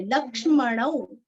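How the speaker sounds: लक्ष्मणौ with a clear long au at the end; लक्ष्मणौ ends in a full au, never a clipped लक्ष्मण. Shown as a demonstration. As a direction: stretch the final औ of लक्ष्मणौ. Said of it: "लक्ष्मण नहीं करना यहाँ पे".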